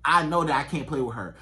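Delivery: ordinary talk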